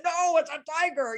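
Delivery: high-pitched mock alarm; alarmed wife. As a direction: insist on the danger as a hammed-up worried wife. Acted wife insists on danger.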